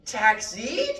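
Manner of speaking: high, loud questioning rise. Retold or imitated. Philosopher to taxi driver, a loud high questioning taxi. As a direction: ask it like a baffled question. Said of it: "in a questioning tone".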